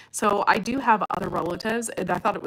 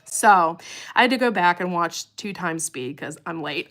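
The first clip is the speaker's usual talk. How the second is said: usual speaking voice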